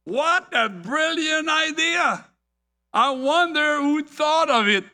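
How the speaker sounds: high-pitched, impressed exclamation; amazed. Acted. Farmer watching the airborne trio, amazed. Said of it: "Farmer Brown in amazement said".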